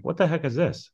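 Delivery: baffled by a strange envelope; puzzled surprise. Retold or imitated. Coach opening a mystery DVD package, puzzled surprise. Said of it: "I was like".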